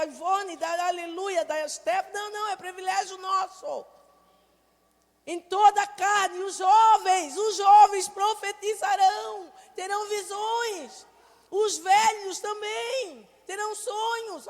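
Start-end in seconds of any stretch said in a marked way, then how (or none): 5.3-14.5 loud, slow, high proclaimed scripture; preaching at full force. Acted. Preacher proclaiming scripture to the congregation, slow and loud. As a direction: proclaim each phrase slowly and loud.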